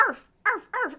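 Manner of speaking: high, short mock barks; imitated dog barking. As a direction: bark like a little lap dog, mockingly. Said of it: "Drew was like".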